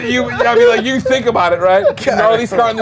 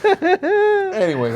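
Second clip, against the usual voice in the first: high giggle into anyways; laughing it off, slow; 9 semitones higher, much slower than usual. Real, not acted. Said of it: "You are the drunk uncle so much right now".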